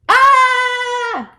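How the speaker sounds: long, high, loud shriek; freaked-out scream. Acted. Frog faced with the turtle, freaked-out scream. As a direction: shriek "aah" in cartoon panic. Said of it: "like freaking out".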